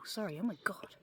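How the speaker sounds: ordinary talk, nothing marked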